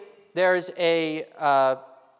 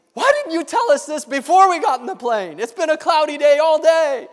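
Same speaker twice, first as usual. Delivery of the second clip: higher, louder, exasperated disbelief; alarmed; 8 semitones higher, 5 dB louder than usual. Acted. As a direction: ask it in rising alarm. Lawyer alarmed pilot passes out.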